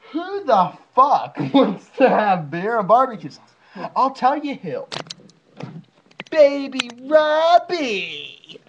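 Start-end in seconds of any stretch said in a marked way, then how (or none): 6.3-8.6 drawn-out high announcer call; slow, high ringside introduction; mock announcer. Announces friend as baby.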